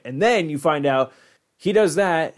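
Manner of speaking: usual speaking voice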